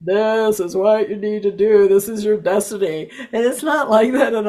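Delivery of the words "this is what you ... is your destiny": loud mock booming voice of God; mock divine pronouncement. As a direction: boom it out like a deity. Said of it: "booming God voice".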